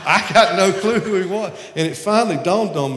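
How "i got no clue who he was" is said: laughing loudly through the words. Real, not acted. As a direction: laugh out the confession, louder. Laughing at his own confusion.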